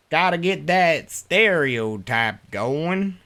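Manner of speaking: southern drawl. Shown as a demonstration. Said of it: "put on some kind of southern drawl".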